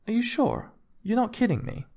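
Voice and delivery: high-pitched doubting questions; incredulous. A narrator's reading. Wife still disbelieves the musician.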